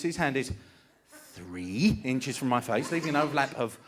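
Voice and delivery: normal talking voice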